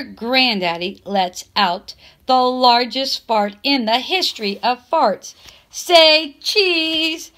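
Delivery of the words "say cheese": higher, louder say cheese; cheery photo call. A narrator's reading. Grandma posing them for photo.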